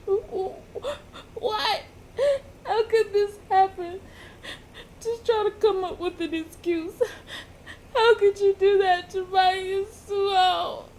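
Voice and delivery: high, whiny mock crying; starting to cry. Joseph grabs a rock over his Yasuho, tearful crying. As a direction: wail it in a high, tearful put-on voice. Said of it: "He's starting to cry".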